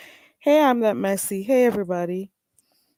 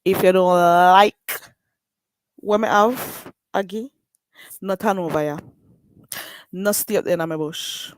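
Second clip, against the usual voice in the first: Jamaican patois. Real, not acted. Daring haters to leave, in broad Jamaican patois. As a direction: lean into thick island patois. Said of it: "If you can't take my accent".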